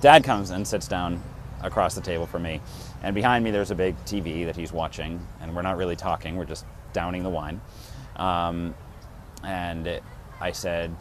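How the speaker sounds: normal talking voice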